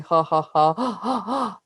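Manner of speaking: hint of an inhaled laugh; imitating his laugh. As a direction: copy the man's odd breathy laugh. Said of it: "every so often he's going".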